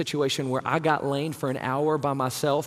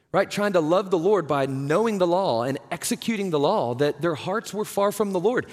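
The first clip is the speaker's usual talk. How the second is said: usual speaking voice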